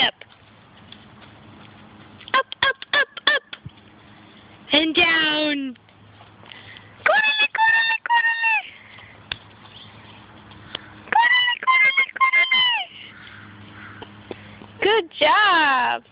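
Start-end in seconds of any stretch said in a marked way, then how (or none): 2.3-3.4 high cheering. Real, not acted. Cheering toddler climbing. 7.0-12.7 high sing-song cheer. Real, not acted. Mom cheering toddler to run, high sing-song.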